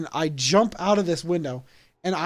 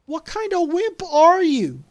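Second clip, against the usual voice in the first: much higher and louder, scornful mock voice; mocking jab; 12 semitones higher, 7 dB louder than usual. Real, not acted. Storyteller mocks the friend who told his mom, high scornful jab. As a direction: sneer at the snitch.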